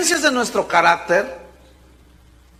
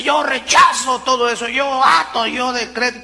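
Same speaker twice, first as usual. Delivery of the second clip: a mocking shout of yo rechazo, higher; mock faith declaration; 5 semitones higher than usual. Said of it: "No la fe que dice".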